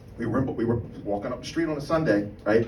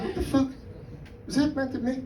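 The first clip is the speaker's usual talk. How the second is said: higher, softer, startled disbelief; high baffled friend; 13 semitones higher, 8 dB quieter than usual. Retold or imitated. A friend reacts to a stranger's insult, high and baffled. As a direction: ask it up high, thrown and unsure. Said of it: "my friend was like".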